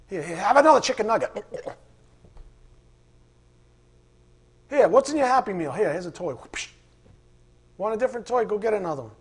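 mock a mother buying her child's silence; mocking distracted mom.

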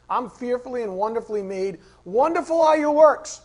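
rising to a joyful loud cry; jubilant. Shown as a demonstration. Preacher reads the psalm to the congregation with loud joy. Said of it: "hear the jubilation of the writer".

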